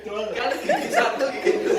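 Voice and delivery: laughing joke. Real, not acted. Pastor joking to the congregation about ngegas, laughing. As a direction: laugh at your own joke.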